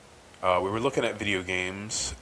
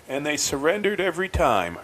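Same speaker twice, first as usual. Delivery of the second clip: retold yell; 7 semitones higher, 4 dB louder than usual. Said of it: "he just yells at the back of the movie theater".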